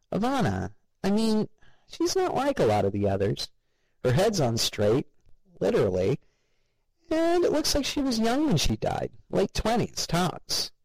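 high, nosy coworker voice; nasal gossip voice. A narrator's reading. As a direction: pitch it up like a gossiping slob. Ted voiced, far higher.

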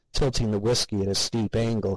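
ordinary delivery, nothing marked

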